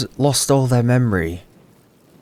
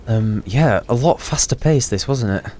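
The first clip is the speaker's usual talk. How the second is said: usual speaking voice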